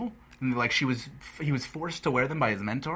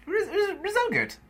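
high silly voice. Retold or imitated. Imitating Steve's odd word.

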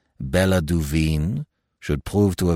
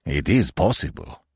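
mildly excited French commissary; a touch of dawning excitement; 3 semitones higher than usual. A narrator's reading. Commissary warming to the blackmail theory, mildly excited. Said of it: "the commissary cried excitedly".

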